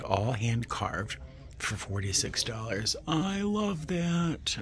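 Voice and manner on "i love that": delighted coo. Real, not acted. Cooing over teak bowl.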